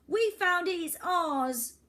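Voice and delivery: put-on high, loud protesting girl; indignant girl. Girl insists the wreck is theirs, high and loud. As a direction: stand up for what you found.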